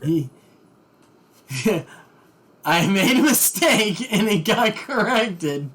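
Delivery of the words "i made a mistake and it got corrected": laughing. Real, not acted. GM laughing at his own error.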